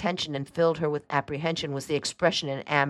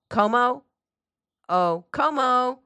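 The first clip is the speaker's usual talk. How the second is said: higher, raised calling voice; 9 semitones higher, 4 dB louder, slower than usual. A narrator's reading. Aunt summons the houseboy, calling out high. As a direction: call the servant in from another room.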